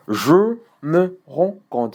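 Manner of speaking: slow, high "je me rends compte", syllable by syllable; mute E sounded. Shown as a demonstration. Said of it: "Ça, c'est les E muets". (« je me rends compte » lentement, chaque E prononcé)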